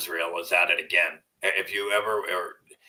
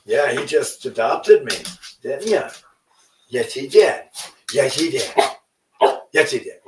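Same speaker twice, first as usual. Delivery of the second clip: excited pet talk; 6 semitones higher, 7 dB louder than usual. Real, not acted. Owner praising his dog directly, high excited baby talk. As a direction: gush at the dog with delight.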